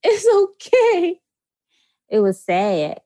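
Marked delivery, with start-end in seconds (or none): 0.0-1.2 mock crying. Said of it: "I said".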